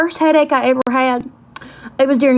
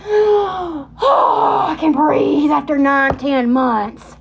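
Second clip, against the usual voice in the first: huge relief; 3 semitones higher, much slower than usual. Retold or imitated. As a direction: act out a breath finally let go. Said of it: "you know, that relief".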